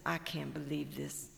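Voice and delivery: low, soft muttered disbelief; disbelieving hawk. Acted. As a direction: shake your head at him under your breath. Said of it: "He says".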